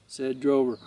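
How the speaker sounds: usual speaking voice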